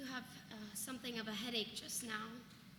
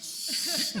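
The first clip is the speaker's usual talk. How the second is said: hushing; 5 semitones higher, 11 dB louder than usual. Acted. Hushing so no one hears.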